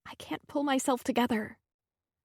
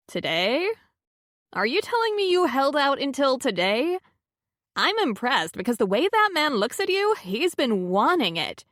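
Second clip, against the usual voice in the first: teasing friend; 5 semitones higher, 4 dB louder than usual. A narrator's reading. Carlotta teasing.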